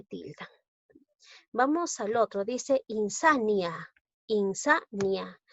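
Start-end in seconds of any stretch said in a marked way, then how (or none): 2.9-5.4 "insania" slowed into in-sa-nia; teacher syllabifying "insania"; syllable by syllable. Shown as a demonstration. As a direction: sound out "insania" in measured syllables. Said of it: "Tiene tres sílabas".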